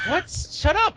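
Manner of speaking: high, loud shut-down; shouted retort. Acted. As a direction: yell back to silence them. Captain snaps back in acted exchange.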